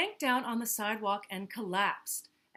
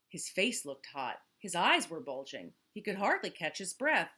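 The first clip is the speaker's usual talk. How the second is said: usual speaking voice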